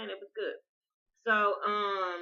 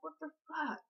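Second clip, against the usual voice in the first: shocked; 10 semitones higher, 7 dB quieter than usual. Retold or imitated. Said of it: "I'm like".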